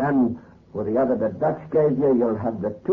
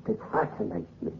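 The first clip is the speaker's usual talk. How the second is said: hushed whisper; 3 semitones higher, 9 dB quieter, faster than usual. Acted. Besotted groom murmuring to bride.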